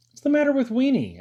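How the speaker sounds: high, loud startled ask; boy startled by the barking; alarmed question. A narrator's reading. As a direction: blurt it out, puzzled and worried. Boy hears dog barking.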